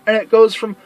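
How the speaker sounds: ordinary delivery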